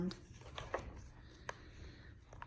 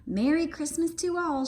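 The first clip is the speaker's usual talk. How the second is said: cheerful shout; 6 semitones higher than usual. A narrator's reading. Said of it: "shouted Froggy".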